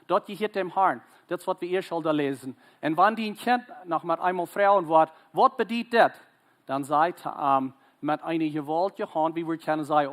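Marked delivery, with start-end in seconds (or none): 5.3-6.1 put-on the question pitched high and loud, like a child asking; child's curious question. Preacher voicing a child who asks about the feast, high and loud.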